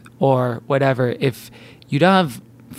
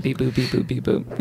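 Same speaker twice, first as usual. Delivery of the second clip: soft, low computer bleeps; mock computer beeps; 4 semitones lower, 7 dB quieter than usual. Co-host pretends to do the math, mock computer beeps. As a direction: beep quietly like a toy calculator.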